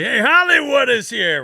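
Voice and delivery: high, loud mocking announcement; mocking coach. Coach announcing the rookie's arrival, loud mocking call. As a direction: crow it out to tease the new guy.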